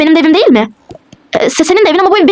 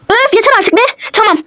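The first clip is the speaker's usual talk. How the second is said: fed-up outburst; 9 semitones higher than usual. Acted. Girlfriend snaps during quarrel.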